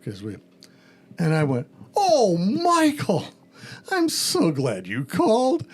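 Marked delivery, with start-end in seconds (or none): 1.9-5.7 high, slow, beaming welcome; gushing overjoyed greeting. Retold or imitated. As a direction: lay it on thick like a delighted host.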